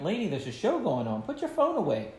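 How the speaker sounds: calling her out. Retold or imitated. Magician to lady on her phone during the show, mild call-out. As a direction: lightly scold someone, pitch jumping up. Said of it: "I was calling her out".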